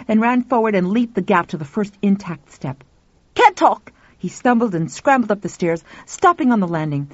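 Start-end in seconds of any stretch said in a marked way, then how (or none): 3.3-3.9 high, loud exclamation; raised, urgent cry; urgent call. A narrator's reading. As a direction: shout it on the run. Calls out while dashing upstairs.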